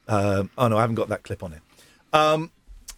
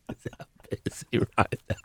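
whispered aside; 5 semitones lower, 8 dB quieter than usual. Pretend. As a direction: breathe it under your breath. Repeats line in a whisper.

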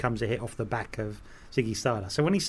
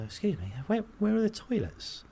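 a hint of a timid rock star; 8 semitones higher, 4 dB quieter than usual. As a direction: ask it lightly in a put-on voice. [name] voiced asking bloke.

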